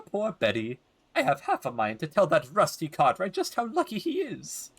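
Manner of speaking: put-on high, swooping old lady voice; gossipy old woman, lamenting. Old neighbor tells of troubled family, high lamenting gossip. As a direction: lament like a nosy elderly neighbor.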